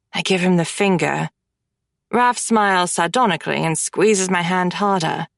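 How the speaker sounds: ordinary delivery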